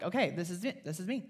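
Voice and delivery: low, soft, defeated self-talk; resigned. Retold or imitated. Speaker admitting her anxiety to herself, resigned. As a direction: give in quietly, voice sinking. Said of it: "Kind of just resigned myself".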